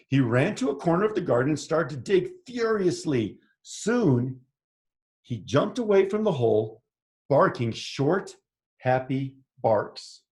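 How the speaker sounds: animated picture-book narration; story read to children. A narrator's reading. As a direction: read it out with lively swoops for young listeners. Reads picture book aloud.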